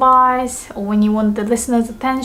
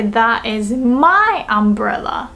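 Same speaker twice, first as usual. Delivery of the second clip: slow, with "my" drawn out; teacher's emphatic "my"; stress on MY; slower than usual. Shown as a demonstration. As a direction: hit "my" to claim the umbrella. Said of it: "So we wanna say".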